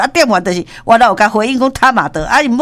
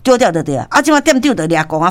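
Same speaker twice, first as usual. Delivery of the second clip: ordinary delivery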